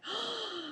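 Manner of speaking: soft quick gasp of happy surprise; delighted gasp. Real, not acted. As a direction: catch your breath, pleased and amazed. Delighted she is obeying.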